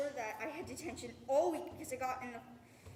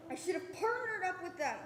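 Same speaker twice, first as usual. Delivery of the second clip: normal talking voice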